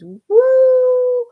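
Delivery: a whooping cheer, high and loud; loud high woo. Real, not acted. A reader whoops at the tarot card, high and loud. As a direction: whoop with delight. Reacting to a reading.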